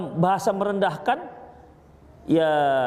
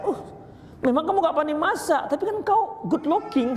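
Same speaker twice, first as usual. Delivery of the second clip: higher, fast teasing husband voice; playful husband; 7 semitones higher than usual. Retold or imitated. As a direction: josh her while flattering. (suami menggoda istri dengan nada tinggi dan cepat)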